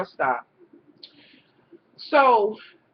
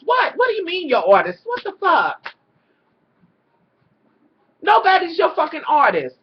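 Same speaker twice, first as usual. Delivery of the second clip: high, louder, fast outraged disbelief; real exasperation at his nonsense; incredulous rant; 5 semitones higher, 5 dB louder than usual. Real, not acted. Vlogger scolding Stevie over his artist claim, a loud incredulous rant.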